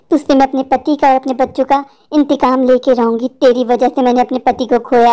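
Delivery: low-pitched, unrelenting witch; dark, low voice sworn to revenge; vengeful witch. Acted. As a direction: refuse his plea in a deep witch voice. Witch refuses to let go.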